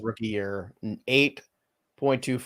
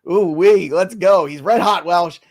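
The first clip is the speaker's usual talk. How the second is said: excited; 6 semitones higher, 9 dB louder, slower than usual. Real, not acted. Excited about home run.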